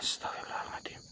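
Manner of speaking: breathless whisper under the breath; whispered prayer. Real, not acted. Host startled in the dark, whispering a prayer. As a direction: whisper it so nothing hears you. Startled at night during hunt.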